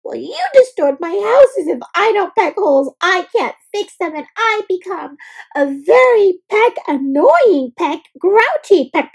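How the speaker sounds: high, chirpy, peeved bird voice; indignant woodpecker. A narrator's reading. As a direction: woodpecker snapping back at bear. Woodpecker answers bear back.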